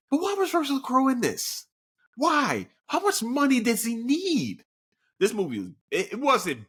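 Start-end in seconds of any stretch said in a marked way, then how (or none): 0.1-4.6 high-pitched incredulous complaint; exasperated. Acted. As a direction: demand an answer in exasperation, voice pitched up. Imagined exasperated reaction while watching.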